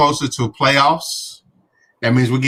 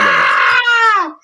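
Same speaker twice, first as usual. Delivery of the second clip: gleeful yell; 4 dB louder than usual. Real, not acted.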